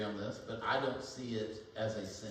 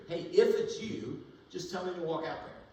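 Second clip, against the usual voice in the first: higher, eager voicing of Peter; 6 semitones higher, 5 dB louder than usual. Pastor voicing Peter asking Jesus from the boat, higher and eager. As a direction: call out to Jesus like an eager fisherman.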